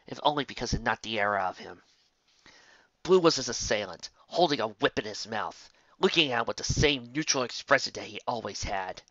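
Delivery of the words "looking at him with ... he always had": usual speaking voice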